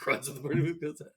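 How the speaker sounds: ordinary talk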